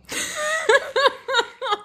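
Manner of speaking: squealing laugh fit; high wheezing laugh. Real, not acted. Laughing hard at joke.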